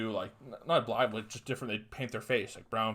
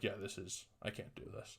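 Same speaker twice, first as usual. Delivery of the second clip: muttered giving up; 4 semitones lower, 16 dB quieter than usual. Retold or imitated. Retelling quitting Twitter in grade nine.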